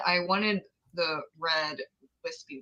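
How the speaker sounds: normal talking voice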